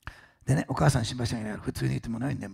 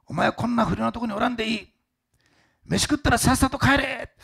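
bossy command; 11 semitones higher, 6 dB louder than usual. Retold or imitated. Company president at the party ordering the young employee home. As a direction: send him home like a jovial boss.

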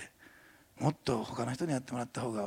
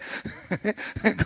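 light laugh; 7 semitones higher, 4 dB louder than usual. Real, not acted. Laughing after shy amen demo.

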